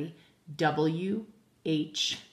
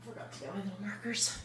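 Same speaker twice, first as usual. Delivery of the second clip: quick whispered aside to herself; 3 semitones higher, 10 dB quieter, faster than usual. Real, not acted. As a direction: mutter it fast under your breath, not for the class. Teacher's muttered aside to herself.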